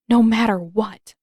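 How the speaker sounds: plain reading voice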